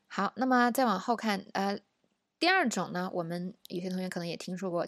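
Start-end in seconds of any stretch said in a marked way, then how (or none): none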